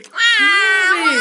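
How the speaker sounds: put-on high, loud baby wail on "pleurait."; wailing like a crying baby. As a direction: stretch "pleurait." into a rising wail. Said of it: "qui pleurait".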